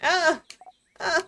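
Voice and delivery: startled shouts; startled yells. Acted. Yelling in battle.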